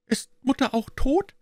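put-on very high child's voice asking; small girl questioning about her mother; high-pitched five-year-old's question; little girl. A narrator's reading. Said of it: "Die Kleine".

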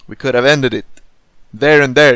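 loud, exasperated rant; frustrated outburst. Real, not acted. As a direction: blurt it out in real frustration. Frustrated rant over game choice.

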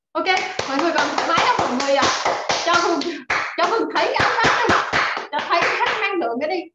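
loud, high, fast hype calls to the crowd; hyped-up shout. Real, not acted. Host firing up the Zoom audience with a loud excited shout. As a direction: pump up the room like an MC.

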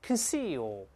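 conceal drawn out with a long ee; pronunciation model. Shown as a demonstration. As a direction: say conceal for the class, unstressed first syllable and long second. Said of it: "我们大声来一遍".

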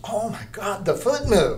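high, loud mock shock; startled gasp. Wife startled when the sculpture's foot moves, high shocked cry. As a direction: jump as the statue moves. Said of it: "And she goes".

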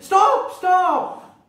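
high, loud cry to halt the bullocks; farmer's shout. Acted. Farmer yelling at his bullocks, loud and high. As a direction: yell stop at the bullocks. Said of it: "the farmer Falgu shouted".

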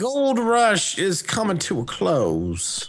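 high, slow Wild West drawl; Old West American accent. Pretend. Gamer play-acting in a western town, Old West accent. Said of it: "That's a pretty good accent".

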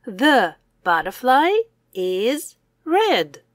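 normal talking voice

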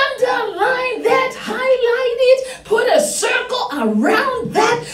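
high, emphatic preacher with stretched key words; fired-up preaching. Real, not acted. Preacher urging congregation to underline it, fired-up preaching. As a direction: drive the verse home with rising fervour.

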